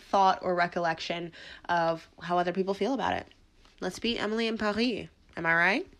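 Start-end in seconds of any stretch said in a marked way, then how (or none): 3.8-5.1 "Paris" said as the French "Pa-ree"; French pronunciation. Shown as a demonstration. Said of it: "flaunt my French accent".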